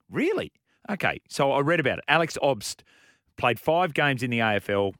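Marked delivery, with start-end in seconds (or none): 0.1-0.5 high disbelieving really; surprised really. Retold or imitated. Retelling his reaction to Tracy, surprised. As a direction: leap up in mock surprise. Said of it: "I'm like".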